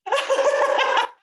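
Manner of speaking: high delighted laugh; real laugh. Real, not acted. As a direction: laugh out loud at the class answer. Laughs after asking class.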